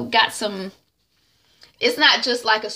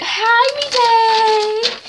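hammed-up coo at a crush; flirty singsong greeting; 8 dB louder than usual. Hadisa flirtily greets her crush.

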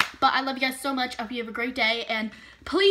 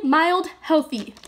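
ordinary talk

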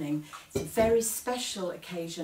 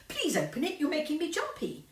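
jumpy grandma; 9 semitones higher than usual. Acted. Grandma urging nervously.

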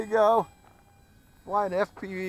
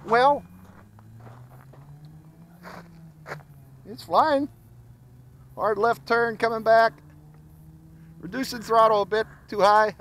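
higher, louder excited play-by-play; 4 semitones higher, 6 dB louder than usual. Real, not acted. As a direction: call out the flight thrilled. Live piloting, excited.

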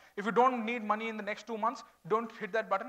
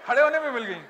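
playful teasing; 3 semitones higher, 10 dB louder, much faster than usual. Real, not acted. Teasing student, crowd laughing.

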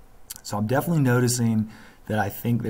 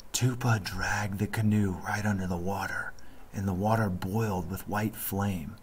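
softer, hushed storyteller's voice; hushed old man; 5 dB quieter than usual. A narrator's reading. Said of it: "The old man's voice dropped to a whisper".

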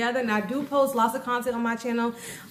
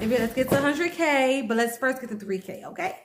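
normal talking voice

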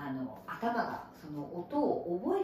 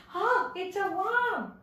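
high, drawn-out gasp of discovery at a worm; hammed-up surprised exclamation; mock startled; 10 semitones higher, 4 dB louder, much slower than usual. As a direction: play-act alarm at spotting a worm, slowly. Acts out spotting a worm.

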